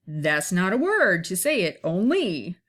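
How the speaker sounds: object, then swoop up on the last syllable of only; mimicked wrong stress. Shown as a demonstration. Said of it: "if it was in iambic".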